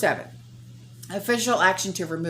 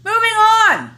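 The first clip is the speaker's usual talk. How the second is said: curt dismissal; 10 dB louder than usual. Real, not acted. Abrupt end of the rant.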